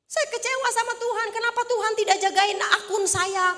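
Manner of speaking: high-pitched aggrieved imitation; hammed-up, indignant lament; mock angry complaint. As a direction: imitate an upset pastor protesting to God. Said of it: "Dia marah-marah, kesal sama Tuhan".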